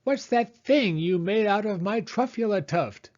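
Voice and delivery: high, indignant creature shout; shouted and puffed. A narrator's reading. Lorax confronts the Onceler over the tuft, high and indignant. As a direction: puff up and demand an answer. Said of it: "as he shouted and puffed".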